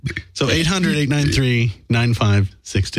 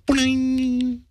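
high boing sound effect; 12 semitones higher, 3 dB quieter than usual. Pretend.

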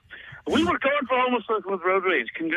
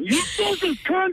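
playful high-pitched jab, laughing; mock insult to grandma; 11 semitones higher, slower than usual. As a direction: tease her, high and laughing. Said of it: "me talking to my grandma".

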